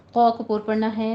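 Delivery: normal talking voice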